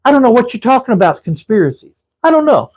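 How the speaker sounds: Sal playing dumb. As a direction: brush it off like a mobster who saw nothing. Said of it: "That's what he talks like that".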